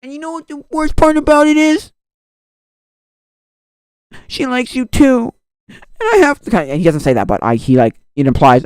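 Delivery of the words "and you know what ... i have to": raised, high and loud, imitating a hurt friend; acted upset retort. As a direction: play an agitated confession. Acting out the confrontation's reply.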